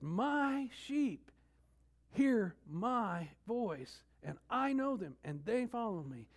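"My" and "I" high and punched; emphatic stress. Shown as a demonstration. Preacher fixing attention on the shepherd, heavy stress. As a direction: stretch "hear my voice" very slow, lift "My" and "I". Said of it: "the fixation is there".

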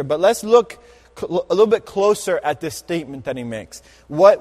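ordinary talk, nothing marked

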